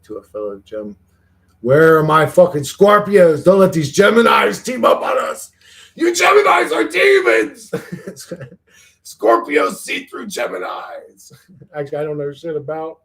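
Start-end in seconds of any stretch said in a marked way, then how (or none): 1.6-7.7 loud, high mock battle cry; rally the troops, hamming it up; playful rallying shout. Calling Scorpios in chat to unite against Geminis, shouting. 9.0-11.3 mock shout. Mock war cry at Geminis.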